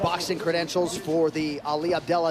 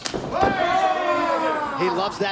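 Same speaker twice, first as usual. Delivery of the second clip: excited; 11 semitones higher, much slower than usual. Real, not acted. Commentator reacts to kick.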